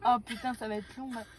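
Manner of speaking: sore throat, strained and soft; swollen tonsils voice. Real, not acted. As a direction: talk through a swollen throat. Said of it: "T'as tant mal que ça?".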